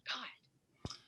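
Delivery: whispered God under breath; whispered disgust. Acted. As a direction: hiss it to yourself in disgust.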